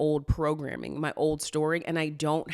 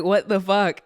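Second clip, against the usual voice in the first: louder annoyed what the fuck; 3 semitones higher, 7 dB louder than usual. Retold or imitated. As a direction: punch it out as the second missed call stings. Second unanswered call, angrier.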